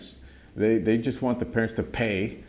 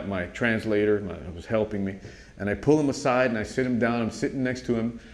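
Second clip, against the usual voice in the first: ordinary talk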